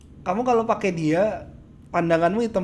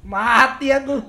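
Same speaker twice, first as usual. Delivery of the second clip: laughing; 6 semitones higher, 7 dB louder, slower than usual. Real, not acted. Laughing at dying in game.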